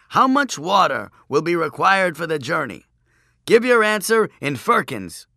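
loud, high examiner's demand; droning chief priest. A narrator's reading. Chief priest demanding Joshua's answer, loud droning recital. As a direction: ring out the final question of a long recital. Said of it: "the chief priest droned on".

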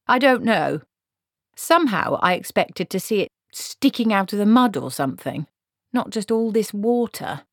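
puzzled Granny figure. Acted. Granny looking over the flood water, puzzled and dissatisfied.

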